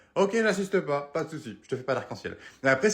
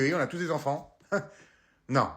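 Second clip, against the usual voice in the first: ordinary delivery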